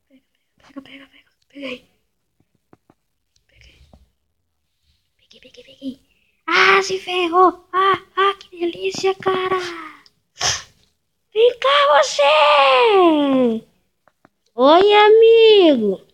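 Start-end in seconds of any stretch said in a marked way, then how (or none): 0.1-6.0 whisper. Real, not acted. Sneaking up on a player. 11.3-16.0 taunting. Real, not acted. Taunting another player before eating.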